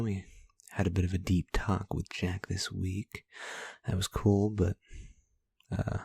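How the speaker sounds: usual speaking voice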